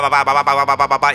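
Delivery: niño machacón, algo más fuerte; berrinche de niño. Retold or imitated. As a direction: repite papá sin parar, caprichoso. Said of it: "Y el papá explota". (niño insistente en el súper, un poco más fuerte)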